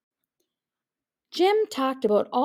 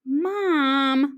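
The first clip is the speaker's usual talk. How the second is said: moaned; 5 semitones higher, 5 dB louder than usual. A narrator's reading. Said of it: "moaned Jim".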